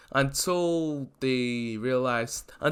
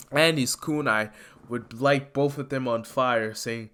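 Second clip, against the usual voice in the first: ordinary talk, nothing marked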